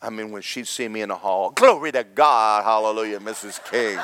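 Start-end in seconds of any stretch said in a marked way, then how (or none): 1.5-4.0 loud burst, stretched opening then easing off; hammed-up frantic show of worship; overeager praise. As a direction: blurt out loud praise to impress her. Imitating his overeager hallway greeting.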